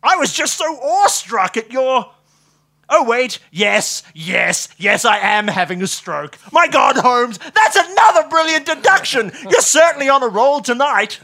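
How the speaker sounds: high, loud, manic delight; awestruck gushing. A narrator's reading. Watson cheerfully confirms his stroke to Holmes, awestruck. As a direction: babble excitedly, building to an ecstatic cry.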